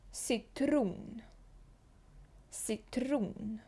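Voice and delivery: «Цитрон» with S and O near U; «Цитрон» said as sitrun, falling; «Цитрон» the Swedish way, twice; Swedish citron. Shown as a demonstration. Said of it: "Літера С".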